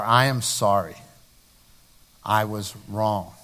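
soft and slow, sincere; tender apology. Shown as a demonstration. Preacher showing the congregation a sincere apology. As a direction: say it gently like you mean it.

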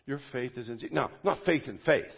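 usual speaking voice

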